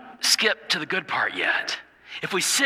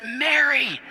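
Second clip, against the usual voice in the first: called name; 10 semitones higher than usual. Acted. Jesus calls her name.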